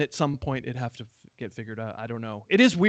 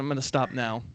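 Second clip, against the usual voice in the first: ordinary talk, nothing marked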